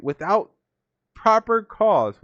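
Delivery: "Without proper cause" rising high and slow, leaning on "proper"; stress on proper cause. Real, not acted. Preacher to his listeners, "proper cause" stressed high and slow.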